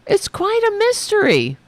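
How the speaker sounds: high, sarcastic sing-song; mock-baffled echo. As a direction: parrot the pundit with fake wonder. Mocking the pundit's line.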